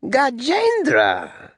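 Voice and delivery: high-pitched, slowly savoured word; Alexander. Acted. General repeats the soldier's name, high-pitched. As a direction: draw the name out high, then let it fall. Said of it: "He has a high-pitched voice".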